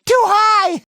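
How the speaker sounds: high-pitched cry of alarm; alarmed shout. Acted. Hero alarmed at the height, high panicked shout.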